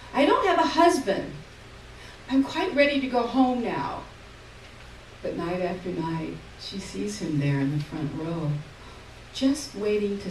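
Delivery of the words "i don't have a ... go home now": put-on high, loud old mother; an old mother telling her daughters she is ready to go home; old Ma's voice. A narrator's reading. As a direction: answer like a confused old woman.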